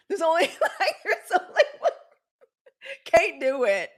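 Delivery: high laughing fragments; cracking up mid-joke. Real, not acted. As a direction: try to talk through laughter. Laughing through line.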